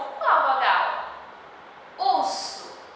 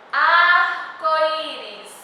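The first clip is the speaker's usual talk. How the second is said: slow clear modelling; 4 dB louder, slower than usual. Shown as a demonstration. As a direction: stretch each syllable of Arco-íris slowly. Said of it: "teacher spells out word slowly".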